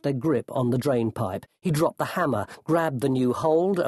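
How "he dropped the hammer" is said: usual speaking voice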